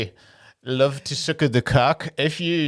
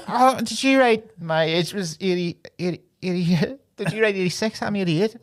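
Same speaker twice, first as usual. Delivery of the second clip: put-on Irish accent; 5 semitones higher than usual. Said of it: "So funny in her accent too".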